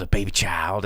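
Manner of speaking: ordinary talk, nothing marked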